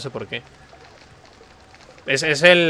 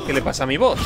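ordinary talk, nothing marked

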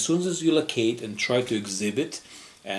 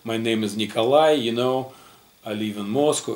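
Russian accent; 3 semitones higher, 4 dB louder, faster than usual. Shown as a demonstration. Teacher shows students his old Russian accent, thick Russian accent. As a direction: demo of a heavy Russian accent in English, quick start. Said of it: "if I would speak Russian accent ... it will sound like this".

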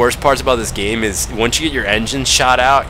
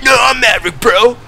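higher, louder mock-heroic insistence; Maverick line; 12 semitones higher, 5 dB louder than usual. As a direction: push the movie-hero line over the top. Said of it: "And I'm like".

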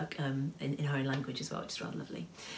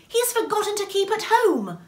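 very high, raised character voice; alarmed exclamation; 8 dB louder than usual. A narrator's reading. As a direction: cry out in dismay.

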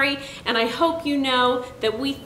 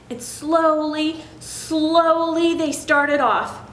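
usual speaking voice

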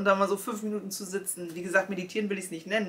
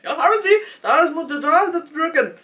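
higher, louder imitated Indian accent in German; 9 semitones higher, 10 dB louder than usual. Hotline agent explaining the button, hammed Indian accent. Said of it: "ein kleiner Inder".

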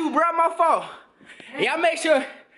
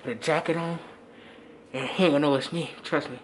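hushed; 9 semitones lower, 5 dB quieter than usual. Real, not acted. Whispering before the prank.

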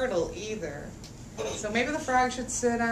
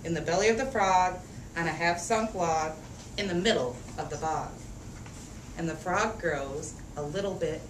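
lively picture-book read-aloud; reading to children; 5 semitones lower than usual. A narrator's reading. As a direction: read it out for a room of little kids. Reads picture book to kids.

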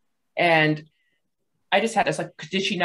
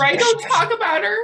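mock indignation; 6 dB louder than usual. Continuing the parody of the suspect.

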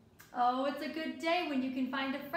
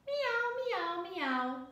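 high mewing meow, then dropping; kitten meow; 4 semitones higher, slower than usual. Acted. Kitten answering the child, meowing. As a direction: mew it like a little kitten. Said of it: "said the kitten".

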